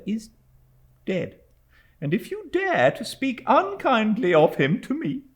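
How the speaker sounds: lightly voiced young mother, a flash of defiance; indignant young mother. A narrator's reading. Widow defending her dead husband, mild indignation. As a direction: bristle at her for speaking ill of him.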